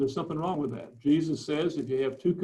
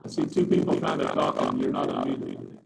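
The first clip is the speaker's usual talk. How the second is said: garbled, underwater-sounding mic; glitching audio. Real, not acted. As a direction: voice muffled like underwater. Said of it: "you're going garbled. Your, your voice is garbled".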